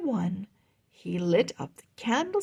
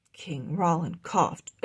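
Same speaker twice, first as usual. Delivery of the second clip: plain reading voice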